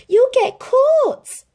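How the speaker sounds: high, loud and slow warning; yell. A narrator's reading. Lady warns Tramp in the coop, yelling. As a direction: shout the warning, drawn out. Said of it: "yelled Lady".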